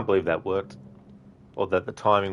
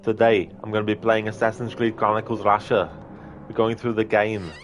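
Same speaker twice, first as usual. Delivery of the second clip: put-on Arnie accent; 3 semitones higher than usual. Mocking Rob's stream intro with an imitated Arnie accent. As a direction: ham up a mock Austrian Arnie voice for the stream greeting. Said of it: "have Arnie's accent by default".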